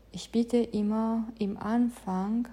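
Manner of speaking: usual speaking voice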